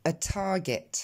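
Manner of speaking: "A target" with a puff after the first t; t aspiré. Shown as a demonstration. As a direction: model English "A target", exploded t. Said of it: "je vous invite à répéter après moi". (« A target » avec un t soufflé)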